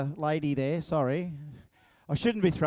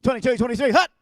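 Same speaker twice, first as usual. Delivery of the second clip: loud, higher snap count shouted to the crowd; quarterback snap call; 6 semitones higher, 9 dB louder, faster than usual. Pretend. Pretend quarterback shouting the snap count to the crowd. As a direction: call the snap like it's a real game. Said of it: "just pretend I'm the quarterback".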